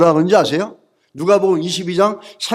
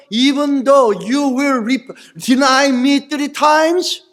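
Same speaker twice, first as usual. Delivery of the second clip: gently voicing Jesus, slow and a little higher; 4 semitones higher, much slower than usual. Preacher voicing Jesus to Peter, slow and tender. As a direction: let Jesus speak slowly, pleading.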